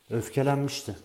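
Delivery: ordinary delivery, nothing marked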